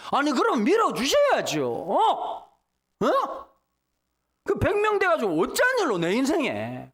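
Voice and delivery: 높고 크게 억울하게 따지듯; 하나님께 대드는 목소리로; 따지는 항의. Retold or imitated. (억울함에 높아진 항의조)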